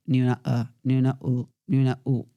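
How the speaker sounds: mock muffled "A" and "U"; poor articulation. Shown as a demonstration. Coach showing vowels gone wrong, muffled "A" and "U". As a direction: let "A" and "U" blur in a barely shaped mouth. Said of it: "así vamos mal".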